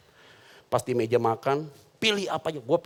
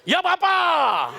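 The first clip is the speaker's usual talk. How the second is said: much higher, very slow called-out yes sir; drawn-out shout; 12 semitones higher, much slower than usual. Acted. Preacher clowning, calling out yes sir, slow and high.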